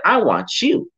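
high-pitched, fervent coach picking a player; earnest and high, urging the underdog on; impassioned acted appeal. God picking the worst kid for his team, impassioned.

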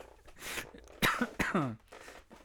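harsh real coughing; coughing fit. Real, not acted. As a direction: cough hard, breathless from a ride. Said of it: "Sorry, I'm coughing a lot".

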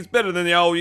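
ordinary talk

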